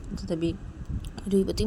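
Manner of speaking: plain reading voice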